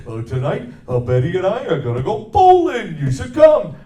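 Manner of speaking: Barney Rubble. Shown as a demonstration. Barney invites Fred bowling, loud and eager. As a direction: invite him bowling, all excited. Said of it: "Barney Rubble".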